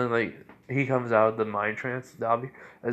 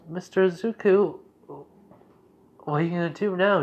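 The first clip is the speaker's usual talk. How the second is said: put-on high, slow little girl voice, louder on the name; a small girl asking, slow and high; 8 semitones higher, 4 dB louder than usual.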